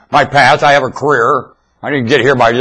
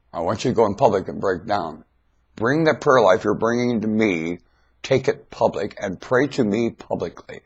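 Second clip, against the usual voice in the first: softer, lower voice for the Lord's command; quiet, solemn voice of the Lord; the Lord speaking; 6 semitones lower, 11 dB quieter than usual. Retold or imitated. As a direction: hush it like a solemn inner voice. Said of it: "the Lord told me".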